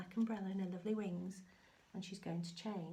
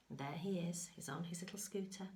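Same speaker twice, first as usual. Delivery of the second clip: whisper. Real, not acted. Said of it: "whispered aside to child".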